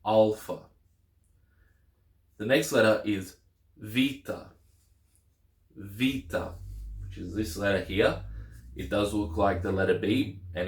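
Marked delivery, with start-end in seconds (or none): 0.0-0.7 Alpha spoken as AHL-fah, open A as in father; slow clear model word. Shown as a demonstration. Teacher modelling Alpha for Greek learners, clear open A. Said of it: "It is pronounced A as in father". 3.8-6.5 Vita said VEE-tah with a V as in vase; Vita in modern Greek. Shown as a demonstration. Teacher demonstrating modern Greek Vita, V sound. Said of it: "In modern Greek it is Vita".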